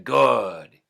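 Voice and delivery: snatch at it like a greedy troll; troll queen hag. A narrator's reading. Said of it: "she said".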